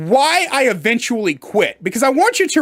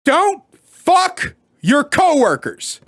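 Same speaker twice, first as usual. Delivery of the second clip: slow, higher, each word weighed; stern rule; 5 semitones higher, slower than usual. Shown as a demonstration. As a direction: lay down the law: Don't fuck your coworkers. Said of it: "the number one rule I have learned".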